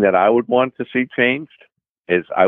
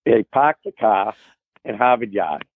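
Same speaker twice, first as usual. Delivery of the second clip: Boston accent; 5 semitones higher than usual. Shown as a demonstration. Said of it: "Can you top that?".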